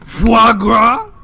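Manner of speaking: loud cartoon Frenchman offering food; mock French accent. Cartoon Frenchman mocking the American, loud hammed-up French accent. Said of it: "talking in a French accent".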